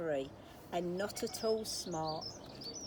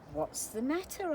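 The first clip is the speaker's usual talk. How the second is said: a higher, lightly voiced giant; high voiced George; 7 semitones higher than usual. A narrator's reading. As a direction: ask kindly with a lifted voice. George asks mouse.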